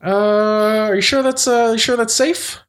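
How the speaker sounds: chirpy high crab pal; crab friend voice. Acted. [name] doubts the swim in character.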